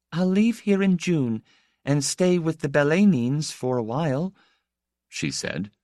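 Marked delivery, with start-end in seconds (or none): 0.1-4.3 put-on high young lady voice with a touch of hesitance; mildly hesitant, pitched up. A narrator's reading. Young woman telling her visitor her plans, mildly hesitant. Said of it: "Her voice was hesitant".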